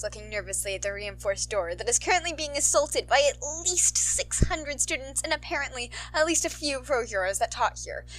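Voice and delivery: plain reading voice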